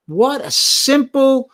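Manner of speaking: high, slow and drawn out in wonder; awed, stretched-out exclamation; slow, amazed. Real, not acted. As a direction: savour each word slowly, amazed. Marveling at kind gesture.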